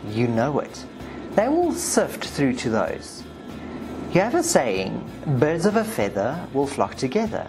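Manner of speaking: usual speaking voice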